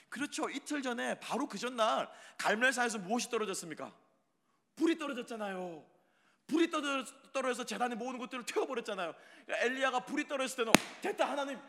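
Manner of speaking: high, loud, fast preaching; preaching at full force. Acted. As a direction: drive it home with fire. Preacher builds to climax.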